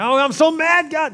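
sudden high exasperated wail; frustrated cry to God. Retold or imitated. Praying person wails their frustration to God, high and exasperated. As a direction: whine it up high like a fed-up child. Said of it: "just get frustrated".

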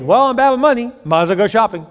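high, loud, carefree justification; breezy mock excuse. Spender just paid, excusing a shopping trip, breezy. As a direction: toss out the excuse brightly, then drop in pitch.

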